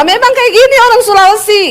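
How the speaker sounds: high-pitched defiant retort; high Sulawesi tone. Retold or imitated. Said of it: "nadamu tuh tinggi".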